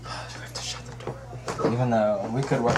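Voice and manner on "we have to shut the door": whisper. Real, not acted. Whispered aside.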